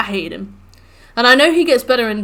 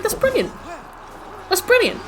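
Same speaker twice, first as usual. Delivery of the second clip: high-pitched, admiring exclamation; delighted approval; 10 semitones higher than usual. Retold or imitated. Player reacting to Kiryu's clever solution, high delighted approval.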